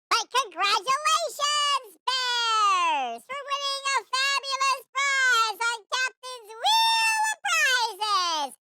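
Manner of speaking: game show announcer. Acted. Announcer congratulates prize winner.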